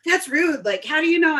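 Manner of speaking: high-pitched imitation of awed fans; mock starstruck gushing; fangirling. Bar-goers spotting [name], fangirling.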